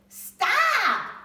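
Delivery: very high, very loud shout; yelled. Acted. Tyra stopping the man grabbing her hair, piercing shout. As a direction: scream it to stop him. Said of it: "Tyra yelled as loud as she could".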